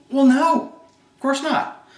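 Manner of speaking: sudden high, loud "Well, no"; surprised denial. Acted. Bob, asked about paying an assistant, answers in loud high surprise. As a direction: blurt it out as if caught off guard. Bob caught out in role play.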